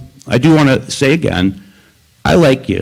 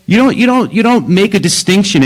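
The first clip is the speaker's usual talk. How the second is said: sharply raised, insistent repeat; emphatic protest; 6 semitones higher than usual. Real, not acted. Speaker protesting to the council, raised and insistent. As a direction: press the point hard, pitch jumping up.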